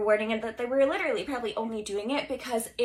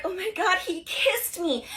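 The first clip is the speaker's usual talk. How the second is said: higher, slow dreamy swoon; swooning movie girl; 7 semitones higher, 3 dB louder, slower than usual. Pretend. Typical film heroine after a first kiss, slow dreamy swoon. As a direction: gush like a smitten movie heroine. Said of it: "any other film would have just been like".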